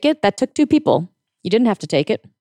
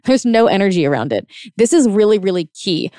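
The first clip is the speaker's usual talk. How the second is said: ordinary delivery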